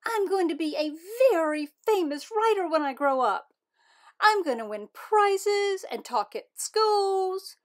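high, loud and eager; bubbling over with excitement; excited. Acted. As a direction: gush like a kid with big dreams. Poppy gushing about being famous.

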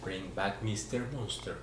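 quick hushed magic spell; kids party magician. Acted. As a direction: rattle off the spell, then call the monster back. Magic trick for a child.

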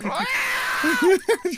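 high, soft screeching laugh; high-pitched animal-like cackle; rabid cat screech. Real, not acted. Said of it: "sound like a rabid cat".

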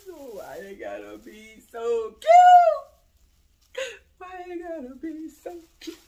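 very high, loud, crying-out gush; squealing mock-tearful delight; overwhelmed squeal. Real, not acted. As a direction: wail it as if it is almost too cute. Overwhelmed by cute ornaments.

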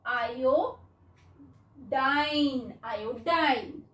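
आयोडाइन slow, last syllable rhyming with "dine"; American pronunciation, long i. Shown as a demonstration. Teacher showing the American reading, आयोडाइन ending in long i. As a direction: say आयोडाइन with a long eye sound at the end. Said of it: "इसको हम जब पढ़ते हैं American".

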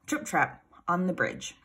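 usual speaking voice

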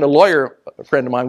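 ordinary talk

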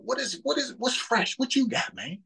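high-pitched, pressing mock questioner; eager questioner. Friend mimicking someone begging for fresh wisdom, high and pressing.

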